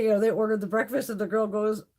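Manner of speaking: usual speaking voice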